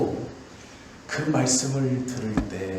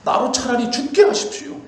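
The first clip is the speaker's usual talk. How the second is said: higher, louder desperate plea to die; anguished cry; 8 semitones higher, 7 dB louder than usual. Retold or imitated. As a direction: cry out as the despairing Elijah. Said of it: "울부짖어요".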